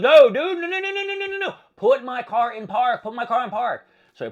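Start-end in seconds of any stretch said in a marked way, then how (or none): 0.0-1.5 high, loud panicked string of no's; frantic no. Retold or imitated. Retelling shouting at the old man leaving the car. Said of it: "I'm like". 1.7-3.9 urgent command. Retold or imitated. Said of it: "I'm like".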